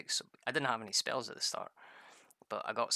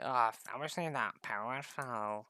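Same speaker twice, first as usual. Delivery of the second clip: mopey mock voice; slower than usual. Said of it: "he was like".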